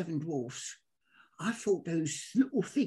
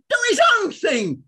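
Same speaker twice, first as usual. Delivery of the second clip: mock shout; 9 semitones higher, 10 dB louder than usual. Retold praise from Johnny Giles.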